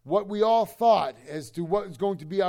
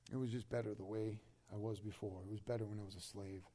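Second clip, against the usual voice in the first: much softer and lower, mopey voice; whiny longing for slavery; 9 semitones lower, 16 dB quieter than usual. Retold or imitated. Preacher voicing backsliders missing slavery, very soft and low. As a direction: moan it like a homesick slave.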